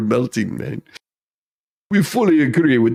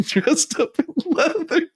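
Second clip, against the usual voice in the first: giggling; 12 semitones higher than usual. Real, not acted. Giggling through a dirty joke.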